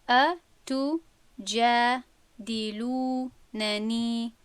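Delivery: ا تو جا دیلو ننی recited slowly, each long vowel drawn; slow recitation. Shown as a demonstration. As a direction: chant it slowly with the vowels stretched. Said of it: "مثال ها رو نظر کنید". (تلاوت آهسته با مد)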